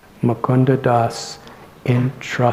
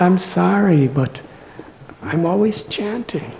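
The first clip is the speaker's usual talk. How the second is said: higher-pitched, gentle acted apology; respectful, loving apology; 6 semitones higher than usual. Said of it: "very respectfully, very lovingly".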